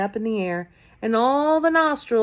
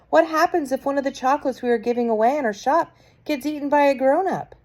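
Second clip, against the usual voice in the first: quick, slightly higher witch question; lightly voiced witch; 7 semitones higher, 4 dB louder than usual. A narrator's reading. Witch in the audience asks the Grand High Witch, quick. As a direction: rush the worried question.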